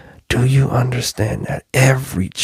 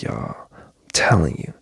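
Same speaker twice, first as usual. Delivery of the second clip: whispered insistence; 5 semitones lower, 6 dB quieter than usual. Real, not acted. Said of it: "emphatic hushed aside".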